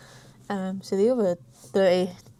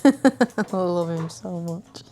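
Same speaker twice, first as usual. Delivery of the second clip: slow, louder, doting over the dog; gushing affection; 7 dB louder, much slower than usual. Real, not acted. Owner doting on his puppy, slow and gushing. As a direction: melt over him, drawn out slowly.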